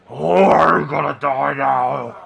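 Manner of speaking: loud, menacing ogre yell. Acted. Ogre brothers yelling at the lizard fighter, loud threatening bellow. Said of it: "his brothers are now yelling".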